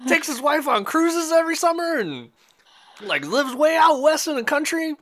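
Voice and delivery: very high-pitched excited imitation; excited recognition. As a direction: squeal it like a man who can't believe it. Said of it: "he was so excited".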